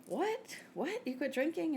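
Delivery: high-pitched, incredulous retold "what?"; astonished questioning, played up; mock disbelief. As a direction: imitate a stunned friend, voice shooting way up. Nail girl's shocked reaction, acted out.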